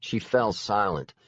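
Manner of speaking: ordinary delivery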